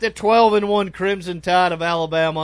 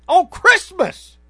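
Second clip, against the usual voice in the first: incredulous; 13 semitones higher, 7 dB louder, much slower than usual. Real, not acted. Mock outrage.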